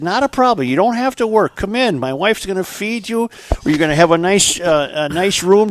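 eager farmer. Acted. Farmer host insisting in joke.

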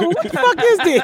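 high, incredulous snap; indignant. Retold or imitated. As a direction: demand an answer, offended. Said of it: "I was like".

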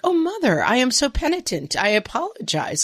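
put-on high, hammy contrite kid voice; lightly voiced prim child; mock penitent child. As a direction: overdo the sorry little angel. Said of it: "they're not gonna suddenly be like".

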